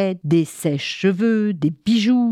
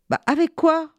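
put-on puzzled child; 9 semitones higher than usual. Léo s'étonne.

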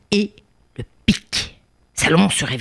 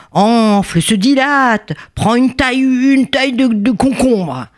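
higher, mounting storyteller wonder; swelling exaggeration; 7 semitones higher than usual. A narrator's reading. Storyteller showing children how huge the nose grows, swelling exaggeration. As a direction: blow it up word by word, pitched higher. Narrator mimics nose growing bigger.

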